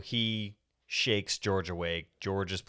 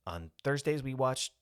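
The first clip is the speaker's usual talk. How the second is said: higher-pitched curt retelling of her refusal; retold curt; 7 semitones higher than usual. Squeaky to Cliff at the door, curt. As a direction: put on her curt brush-off, voice raised in pitch. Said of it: "She's being very curt".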